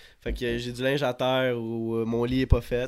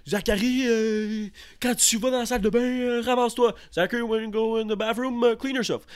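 high-pitched mock parent lecture, French then English; a lightly high, mock parent repeating himself; imitating his parents; 6 semitones higher than usual. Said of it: "il répète".

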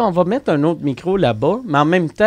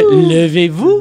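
louder TV host impression with rising intonation; Claire Lamarche; 3 semitones higher, 5 dB louder, much slower than usual. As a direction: imitate a host calling out, lifting the end. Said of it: "mon imitation de Claire Lamarche". (imitation d'animatrice, intonation montante)

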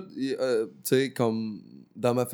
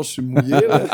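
laughing; 9 dB louder than usual. Real, not acted. Laughing confession.

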